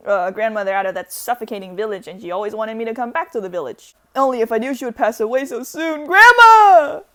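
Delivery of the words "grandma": crying out, much higher and louder; a grandchild wailing for grandma; anguished wail. Acted. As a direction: wail her name in grief.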